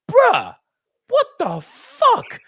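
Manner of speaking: high, drawn-out mock outrage; shocked disbelief. Reacting to friend's outlandish lines to women, shocked disbelief. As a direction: squeal it slowly in appalled disbelief.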